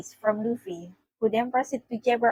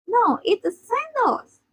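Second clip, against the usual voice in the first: "sandals" drawn out in a high voice; stressed correction; 12 semitones higher, much slower than usual. A narrator's reading. Nami correcting Luffy, "sandals" stressed high and slow. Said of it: "Nami stressed".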